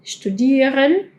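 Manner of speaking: "studieren" slow, with sh-t; German st. Shown as a demonstration. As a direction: spell out "studieren" slowly. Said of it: "Hay verbos como studieren".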